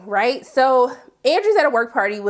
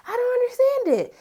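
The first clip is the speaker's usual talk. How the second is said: exasperated; 14 semitones higher than usual. Real, not acted. Exasperated comment.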